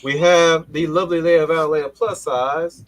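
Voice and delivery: high, loud, drawn-out announcer intro; grand game-show introduction. Pretend. Host introduces guest.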